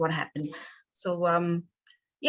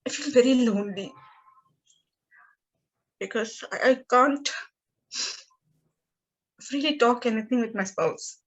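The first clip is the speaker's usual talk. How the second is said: higher, halting voice fighting back tears; emotional, near tears; 3 semitones higher than usual. Real, not acted. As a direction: speak through real tears with long pauses. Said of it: "I'm getting emotional".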